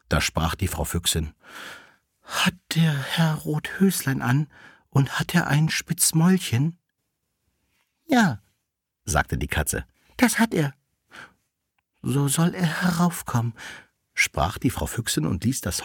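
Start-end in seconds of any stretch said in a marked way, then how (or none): 2.2-6.8 high lingering question of a widowed vixen. A narrator's reading. 8.1-8.4 very high cat reply; high, loud little cat; maid cat. A narrator's reading. Cat answers the vixen. 10.2-10.7 very high cat voice; high, piping cat reply; maid cat. A narrator's reading. Cat confirms to vixen. 12.0-13.5 high-pitched lady fox; high, pleased summons; vixen. A narrator's reading. Vixen accepts the young fox.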